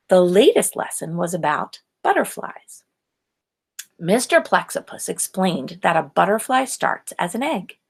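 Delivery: usual speaking voice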